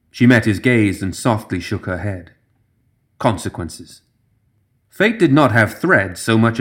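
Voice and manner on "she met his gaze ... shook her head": ordinary delivery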